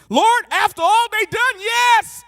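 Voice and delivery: loud, high-pitched cry of disbelief; astonished protest. Acted. Preacher questions the Lord over Israel's sins, loudly astonished. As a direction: exclaim it at full preaching force. Protests to God, answered yes.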